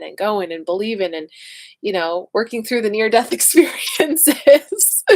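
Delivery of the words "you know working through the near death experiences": slow words broken by a real laugh; laughing through it. Real, not acted. Joking to her audience about nearly dying, laughing. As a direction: laugh it off mid-phrase. Laughing off a near-death story.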